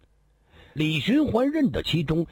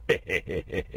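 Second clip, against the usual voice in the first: soft chuckle; 7 semitones lower, 4 dB quieter than usual. Acted. Character chuckles mid-dialogue.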